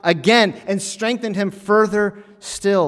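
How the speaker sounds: high, loud sermon climax; loud, driving pulpit proclamation; forceful preaching. Acted.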